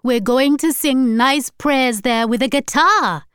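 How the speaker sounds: high, loud, eager grandmother; excited. A narrator's reading. Grandmother adding her plans for singing prayers, excited. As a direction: burst in with delighted plans. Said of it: "added Hortense excitedly".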